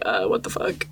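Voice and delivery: low, breathless whisper of disbelief; stunned whisper. Retold or imitated. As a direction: whisper it, shocked and hushed. Retold Poppy stunned by mesmerizing.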